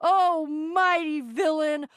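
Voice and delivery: slow, hammed-up theatrical supplication; grandiose grovelling. Begging mercy from a mighty villain, theatrically abject. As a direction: declaim it slowly like an overblown plea. Mock grovelling plea to the pirate.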